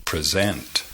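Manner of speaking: weak first syllable, stressed ending on present; stress on second syllable. Shown as a demonstration. Teacher shows learners the verb, stress on second syllable of present. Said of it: "cae en la segunda sílaba".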